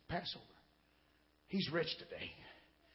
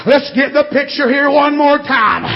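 fervent revival preaching, building louder and higher; 4 semitones higher, 8 dB louder, slower than usual. Real, not acted. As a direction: punch out short phrases with gasped breaths. Preaching at full force.

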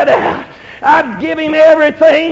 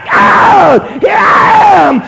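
raised, drawn-out cry to God; 4 semitones higher, 4 dB louder, much slower than usual. Retold or imitated. Preacher retelling his plea to God, crying out. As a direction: call out high and slow, retelling a prayer. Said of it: "I was crying out".

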